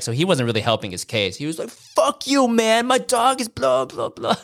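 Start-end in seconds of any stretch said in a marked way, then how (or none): 1.7-4.4 high, mocking furious rant; mock raging Marco. Mocking Marco's rant at neighbours, high mock fury. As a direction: mimic Marco raging back. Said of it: "raging back".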